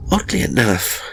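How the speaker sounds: usual speaking voice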